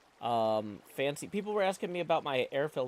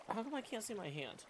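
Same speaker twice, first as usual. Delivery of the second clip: ordinary talk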